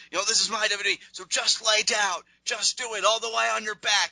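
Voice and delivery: high, pushy, demanding heel voice; bossy Rollins. Retold or imitated. Rollins bossing Kane to lie flat. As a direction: order him down like you own the place. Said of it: "'cause he says".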